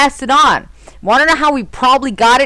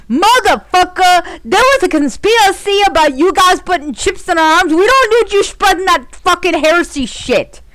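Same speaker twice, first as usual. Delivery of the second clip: angry rant; 7 semitones higher than usual. Retold or imitated. Mock rant at the elites.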